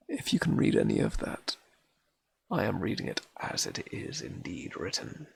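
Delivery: usual speaking voice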